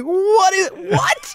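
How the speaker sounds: high, squeaky disbelieving "what?"; mock-shocked squeal; baffled. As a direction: go way up in pitch in total confusion. Stunned pitchers see a horse.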